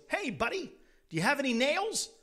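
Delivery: high, loud, punchy cartoon duck; cheeky duck calling out, with quacks; pestering joke duck. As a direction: pester the shopkeeper like a pushy duck. Duck returns to pester owner.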